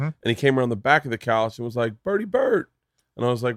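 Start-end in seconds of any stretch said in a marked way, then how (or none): 2.0-2.7 high sing-song greeting. Retold or imitated. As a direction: call the nickname up high. Kevin's greeting in dream.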